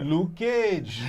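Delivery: loud mock Jamaican call; mimicked Jamaican accent. Mimicking the show's Jamaican villain.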